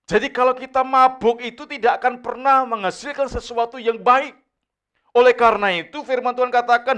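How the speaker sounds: loud, high preaching building to a shout; rising from firm to full-force exhortation. Acted.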